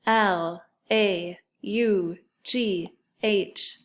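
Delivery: slow, evenly spaced letters; spelling aloud. Shown as a demonstration. Teacher leading sight word review, spelling aloud. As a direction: give each letter its own beat. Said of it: "and spell it".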